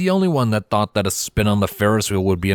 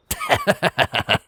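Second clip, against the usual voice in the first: a stallion's amused laugh, pitched high; hearty laugh; 10 semitones higher than usual. Acted. The bronze stallion laughing off Silver's annoyed look, amused. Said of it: "only responded with a laugh".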